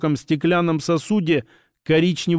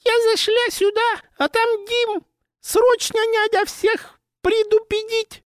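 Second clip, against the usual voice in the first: very high falsetto fake lady; squeaky voice. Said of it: "писклявым голосом сказал".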